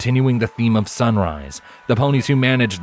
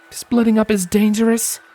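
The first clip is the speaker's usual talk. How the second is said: higher mare's voice, a touch stern; Scarlet, worried; 13 semitones higher than usual. A narrator's reading. Scarlet objecting to Lens, with a frown. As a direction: warn him off the plan. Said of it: "pointed out with a frown".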